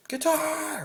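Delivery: high, drawn-out yell held back in volume; mock yell. Shown as a demonstration. Coach showing how to yell a word in your head, stretched mock yell. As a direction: call it out high and long, without real loudness. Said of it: "like you're yelling it".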